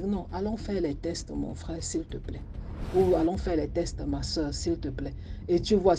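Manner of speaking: ordinary delivery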